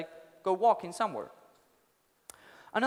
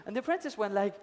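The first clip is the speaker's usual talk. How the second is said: ordinary delivery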